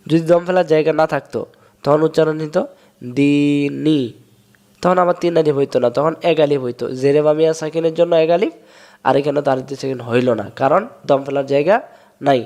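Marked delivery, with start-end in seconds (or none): none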